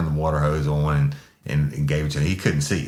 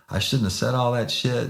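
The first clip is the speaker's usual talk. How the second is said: high-pitched imitation of pleading remorse; mock abject apology; 9 semitones higher than usual. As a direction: apologise over and over like a broken man. Imitating man's contrite apology.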